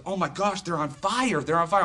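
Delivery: high, loud amazed cry; excited exclamation. Retold or imitated. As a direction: blurt it out, astonished. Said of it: "He goes".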